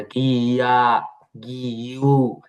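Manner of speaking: "গিয়া, গিউ" slowly drawn out, gi-ya, gi-yu; separate syllables. Shown as a demonstration. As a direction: stretch each part of "গিয়া, গিউ". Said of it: "হবার কথা ছিল এরকম উচ্চারণটা".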